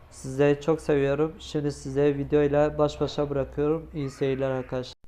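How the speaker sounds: loud, upbeat opening to the viewers; vlog intro. Acted. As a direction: hype up the start of the video. Subscribe appeal and sign-off. (yüksek sesli, enerjik vlog girişi)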